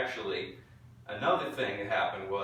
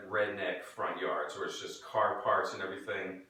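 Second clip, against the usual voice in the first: ordinary talk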